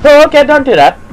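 high loud jolted protest; jump-scare outburst; startled yelp. Real, not acted. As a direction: yelp in fright, then protest. Startled by game.

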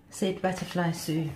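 ordinary delivery, nothing marked

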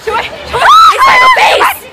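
rapid repeats climbing to a high shriek; excited urging. Real, not acted. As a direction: urge friends, building from eager to squealing. Urging friends to wait for band.

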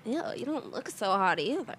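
usual speaking voice

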